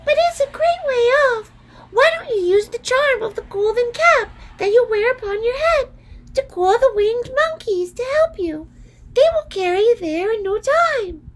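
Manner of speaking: a helpful little queen squeaking advice; tiny mouse queen. A narrator's reading. Mouse queen advising Dorothy about the cap, very high. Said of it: "answered the Queen".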